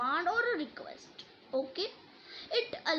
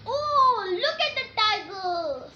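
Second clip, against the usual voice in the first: much higher and louder, thrilled cry; excited exclamation; 8 semitones higher, 9 dB louder, slower than usual. Shown as a demonstration. Teacher modelling an exclamation for the class, excited. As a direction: gasp it out as if you just spotted it. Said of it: "Same expressions".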